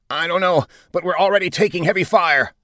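high, harried captain; pressured alarm. A narrator's reading. Captain under fire answering his commander, alarmed.

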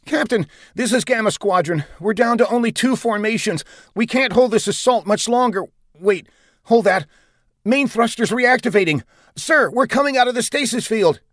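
high, urgent distress report; frantic squadron pilot. Acted. Squadron officer radioing the commander under fire, frantic. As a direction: report breathlessly keen, from danger to hope.

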